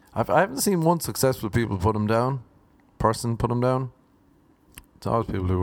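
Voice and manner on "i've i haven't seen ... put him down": ordinary talk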